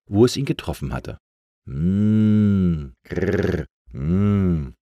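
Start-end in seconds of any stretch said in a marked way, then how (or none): 1.7-4.7 drawn-out hum with a rolled grrr; straining growl. A narrator's reading. Buried letter struggles to get out, straining growl. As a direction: hum and growl like a stuck thing. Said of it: "machte es plötzlich".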